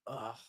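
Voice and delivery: very quiet, weary "ugh"; hushed, worn-out sigh of a groan; exasperated groan. Retold or imitated. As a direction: let out a soft groan of frustration. Acting out his sleepless exasperation.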